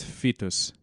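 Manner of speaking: "fetus" with an F sound at the start. Shown as a demonstration. Co-host correcting Vitus for his friend, "fetus" opening on F. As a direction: start "fetus" on an F, German-style. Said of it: "With an F".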